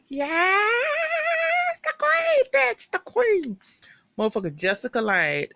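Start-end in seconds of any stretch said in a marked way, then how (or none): 0.1-3.6 shrieking high and loud, drawn out; screaming fan excitement, high and slow; ecstatic hype. Real, not acted. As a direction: squeal it like the diva just walked in. Hyping Jessica Lange's entrance.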